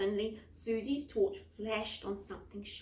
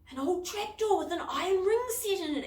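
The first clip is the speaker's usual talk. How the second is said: high, loud discovery; excited exclaim; 9 semitones higher, 5 dB louder than usual. A narrator's reading. Girl discovers a trapdoor and exclaims excitedly. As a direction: announce the find with thrill. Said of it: "exclaimed Susie".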